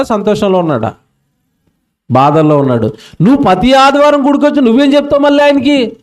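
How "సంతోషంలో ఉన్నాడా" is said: ordinary delivery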